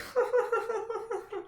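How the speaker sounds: high, rapid run of giggles; imitated stoned giggling. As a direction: reenact an endless stoned laughing fit. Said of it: "I'm just laughing".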